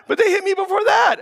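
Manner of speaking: put-on high laughing kid shout; mocking kid. Child excusing himself to dad, high laughing whine.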